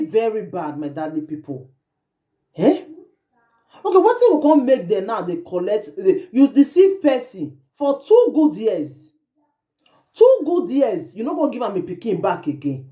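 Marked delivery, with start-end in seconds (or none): 6.0-11.1 slow, stressed outrage on two good years; slow indignant stress; indignant emphasis. Real, not acted. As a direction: drag out the words in disbelief. Vlogger outraged by the story.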